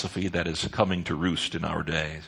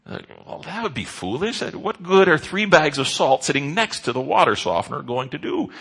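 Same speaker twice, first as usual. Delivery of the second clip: incredulous mock; 6 semitones higher, 4 dB louder than usual. Preacher mocking the foolish excuse.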